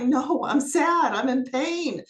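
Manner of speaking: retelling a tearful outburst; mock tearful protest. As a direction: act out being sad and in pain, hammed up a little. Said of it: "I just started crying".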